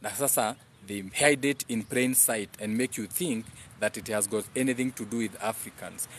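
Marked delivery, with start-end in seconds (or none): none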